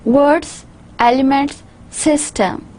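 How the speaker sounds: "Words", "elements", "system" each stressed and set apart; stressed words in isolation. Shown as a demonstration. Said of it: "The underlined characters, they indicate the main stress".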